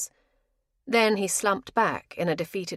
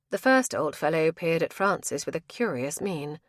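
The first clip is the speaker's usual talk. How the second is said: plain reading voice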